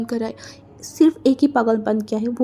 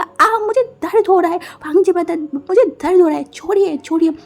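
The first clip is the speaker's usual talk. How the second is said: high, loud cry of pain; pained yell; 7 semitones higher, 3 dB louder than usual. Acted. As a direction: yelp as your ear is twisted. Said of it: "wing literally चिल्ला के बोला". (कान मरोड़े जाने पर दर्द से चिल्लाना)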